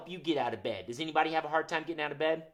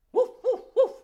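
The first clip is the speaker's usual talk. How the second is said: high, loud playful woofs; dog barking; 10 dB louder than usual. Shown as a demonstration. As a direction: bark like a puppy. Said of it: "What about a dog?".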